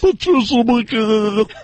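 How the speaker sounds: Patricia McCann. Acted. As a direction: stretch her name out slowly in mockery. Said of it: "Her face matches her voice".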